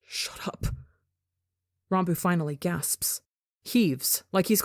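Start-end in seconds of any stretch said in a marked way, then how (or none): 0.1-0.7 unvoiced hissed whisper; whispered command to the voice. A narrator's reading. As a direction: breathe it out, no voice. Whispered inside his head.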